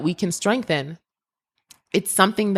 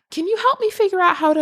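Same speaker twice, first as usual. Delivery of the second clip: much higher, fast, mocking plea; mock stranger asking; 12 semitones higher, faster than usual. People ask the crying girl for help, high mock voice. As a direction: ape a clueless skier's question.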